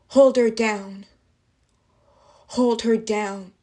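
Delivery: loud crowd yell, easing on the repeat; acted yelling. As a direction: call it out like one of a jeering mob. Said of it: "I heard a bunch of people yelling".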